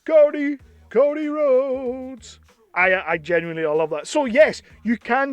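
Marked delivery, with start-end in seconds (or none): 0.0-2.4 high, stretched name chant; drawn-out entrance call; announcer call. Acted. Hailing the wrestler toy, high drawn-out announcer call.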